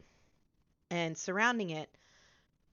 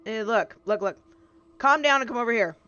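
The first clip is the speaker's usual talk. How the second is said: louder, fast, fed-up calming down; impatient beckoning; 3 semitones higher, 7 dB louder than usual. Acted. Tabby calls the struggling hero over, louder and impatient. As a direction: wave him over, tired of this. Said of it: "she goes".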